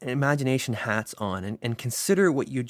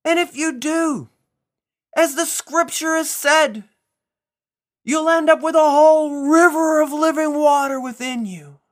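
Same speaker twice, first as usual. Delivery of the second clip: loud proclaiming yell; 11 dB louder than usual. Acted. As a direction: cry it out to the whole temple crowd. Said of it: "he yelled in a loud voice".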